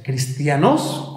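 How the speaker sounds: cristianos stressed on the last syllable, cristia-NÓS; stress on final O. Shown as a demonstration. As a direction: land slowly on the final O of cristianos. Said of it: "con acento en la O".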